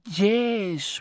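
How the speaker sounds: high, put-on Jose Jimenez Spanish accent. Robert imitating Jose Jimenez on TV, high comic Spanish accent. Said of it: "with his accent".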